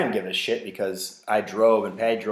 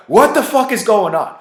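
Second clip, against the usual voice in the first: much louder and higher mock yell; exasperated shout; 10 semitones higher, 9 dB louder than usual. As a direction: blow up in disbelief. Girl pukes a second time.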